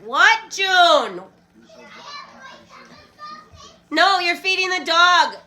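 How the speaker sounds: loud, high call across the room with a laugh; calling out to daughter. Real, not acted. Mother calling out to her daughter feeding the dog, loud half-laughing call.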